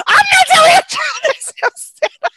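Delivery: high and loud through laughter; laughing refusal. Retold or imitated. Refusing the mom's request, high laughing protest. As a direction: blurt it while cracking up.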